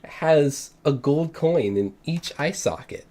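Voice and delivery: slow, hushed reveal; slow suspenseful narration. A narrator's reading. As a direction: build suspense slowly. GM slow dramatic description.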